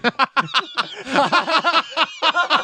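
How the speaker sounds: long high laughing fit; laughing hard. Real, not acted. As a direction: crack up. Laughing at own joke.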